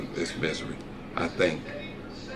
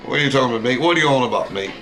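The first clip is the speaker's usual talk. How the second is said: put-on Aussie accent; faster than usual. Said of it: "Love your dad's Aussie accent".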